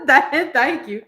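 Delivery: thanks with a laugh in it. Real, not acted. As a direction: chuckle through the thank you.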